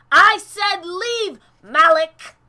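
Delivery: high, loud, slow repeated order; furious command. Acted. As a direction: bite out the order so Malik goes. Character repeats order to Malik.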